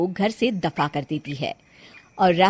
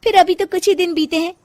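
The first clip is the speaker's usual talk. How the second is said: higher, playful, speeding up; teasing bahu; 7 semitones higher than usual. Acted. Janki teasing her mother-in-law, high and playful. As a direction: tease her sweetly.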